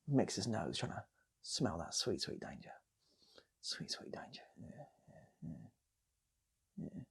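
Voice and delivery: usual speaking voice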